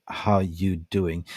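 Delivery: slow, stretched How you doing; drawn-out greeting. Shown as a demonstration. Host greets a viewer in the chat, slowly drawn out. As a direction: draw the greeting out lazily.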